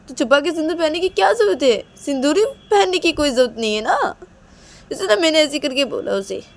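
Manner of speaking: high, loud indignant outburst; angry daughter. Acted. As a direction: argue back heatedly at mother. Daughter argues back at mother. (ग़ुस्से में बेटी की ऊँची, तेज़ आवाज़)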